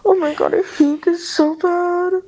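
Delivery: creeped-out, miserable whine; scared, near tears. Real, not acted. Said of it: "I'm gonna start crying".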